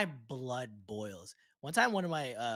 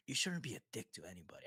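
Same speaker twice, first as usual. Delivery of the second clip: whisper; 3 semitones lower, 8 dB quieter, faster than usual. Real, not acted. Emphatic rant about rude customers.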